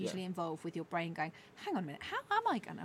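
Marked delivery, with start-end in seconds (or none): 1.5-2.8 high, quick puzzled thought; fast worried self-talk; inner voice. Retold or imitated. Voicing her own brain.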